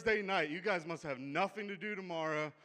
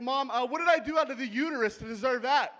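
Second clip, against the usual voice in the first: mock indignant; 6 semitones higher, 7 dB louder than usual. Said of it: "I'll play devil's advocate".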